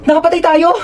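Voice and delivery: loud frightened cry; panicked. Acted. Driver panics after hitting someone, loud cry. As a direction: sound the alarm in panic.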